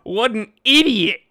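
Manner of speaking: mocking critic. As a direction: sneer it out like a smug commenter. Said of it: "trying to call me out like".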